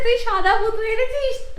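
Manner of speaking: put-on high, laughing toddler voice; mother copying her toddler, laughing; imitating the child. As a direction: mimic the little one through laughter. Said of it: "বলছে".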